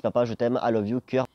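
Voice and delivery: ordinary talk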